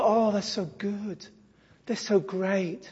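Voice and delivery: soft, slow mock sentimental cooing; mock moved viewer. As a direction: softly imitate a touched, gullible viewer. Mimics gushing video viewers.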